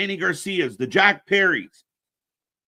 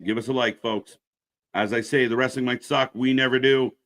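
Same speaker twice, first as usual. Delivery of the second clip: ordinary delivery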